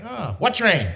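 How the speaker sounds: a hint of a high, innocent seller; playing dumb about the train; lightly voiced seller. Seller voiced in retold story.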